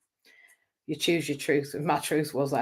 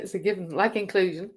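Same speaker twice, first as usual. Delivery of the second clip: usual speaking voice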